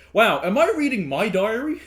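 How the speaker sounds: high, loud amazed exclamation; loud surprise. Real, not acted. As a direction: blurt it out, amazed. Surprised at the diary.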